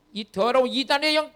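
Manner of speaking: loud, forceful rebuke of a spirit; exorcism rebuke. Retold or imitated. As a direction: rebuke the evil spirit at full volume. Retold rebuke of evil spirit.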